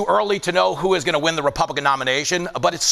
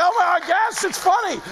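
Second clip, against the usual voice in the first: stunned mock acceptance; 10 semitones higher than usual. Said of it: "You're like".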